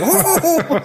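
high laughing whoa; mock surprise. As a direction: act startled, then laugh. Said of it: "He's like".

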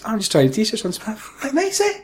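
breezy intruder showing off; cheeky intruder voice. Pretend. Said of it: "walk round to your house".

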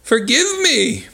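high, drawn-out plea; hammed-up begging for mercy; pleading. A narrator's reading. Merchant begging the beast's forgiveness, high pleading. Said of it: "pleaded the merchant".

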